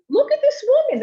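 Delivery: loud, very high outraged cry; rally bystanders with a shrieked accusation; yelling. Retold or imitated. Woman telling bystanders about the mother, yelling. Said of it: "She's yelling and yelling".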